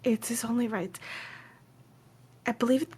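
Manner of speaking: hushed, stammering insistence; earnest quest giver. Acted. Woman insisting helper accept family bracelet, hushed and halting.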